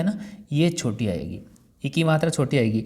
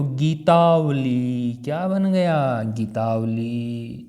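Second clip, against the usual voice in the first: slow question and answer; much slower than usual. Shown as a demonstration. Teacher leads students to the answer. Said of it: "ए और ए मिलके क्या हुआ".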